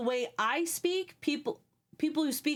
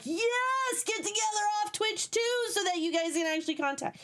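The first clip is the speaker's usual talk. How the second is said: retold squeal of encouragement, pitched way up; excited cheer; 11 semitones higher than usual. Urging friends to connect off Twitch, excited. Retells her own cheering them on.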